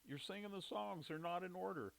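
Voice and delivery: imitating wife. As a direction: play the wife correcting him. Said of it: "She says".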